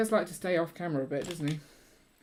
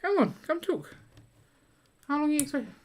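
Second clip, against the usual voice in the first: high coaxing call; 11 semitones higher, 5 dB louder, faster than usual. Real, not acted. Coaxing partner to join.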